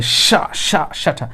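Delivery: "সা" said hard, as a forceful hushing sh; too-hard pronunciation. Shown as a demonstration. Teacher warning learners off saying it hard, too-hard pronunciation. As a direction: overdo the hard opening of "সা, ঠা" as a mistake. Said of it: "শক্ত করে বলা যাবে না".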